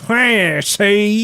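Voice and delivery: high, loud Bugs Bunny accent mockery. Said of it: "that fucking Bugs Bunny accent that he puts on".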